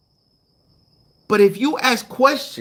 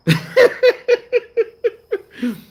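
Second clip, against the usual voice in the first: loud, high laugh; laughing at his own bacon comparison; high burst of laughter; 4 dB louder than usual. Real, not acted. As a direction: crack up at the comparison.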